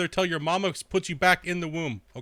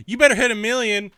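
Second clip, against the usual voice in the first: higher, louder mock demand; mocking a chatter; 7 semitones higher, 8 dB louder than usual. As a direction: whine it like a bratty kid. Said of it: "he's like".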